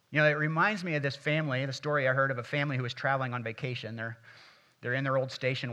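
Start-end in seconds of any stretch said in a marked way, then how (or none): none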